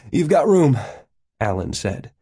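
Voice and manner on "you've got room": high, loud and insistent; raised, eager appeal; urgent pleading. A narrator's reading. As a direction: press your case urgently. Winded man begging a ride.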